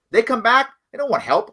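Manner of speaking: usual speaking voice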